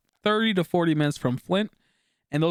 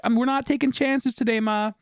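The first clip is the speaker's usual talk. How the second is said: higher, pressing warning to mom; urgent warning to mom; 9 semitones higher than usual. Retold or imitated. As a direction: urge her to stay in the car. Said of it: "I'm like".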